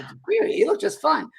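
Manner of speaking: high, puzzled Scottish query; Scottish accent. Retold or imitated. Scottish lady doubts the little girl, high Scottish accent. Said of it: "I'm trying to my Scottish accent".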